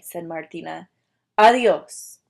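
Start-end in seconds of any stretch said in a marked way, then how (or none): 1.4-2.2 a sharp loud goodbye; loud dismissive farewell. Acted. Martina sends the lizard off with a loud farewell. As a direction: slam the door with the word.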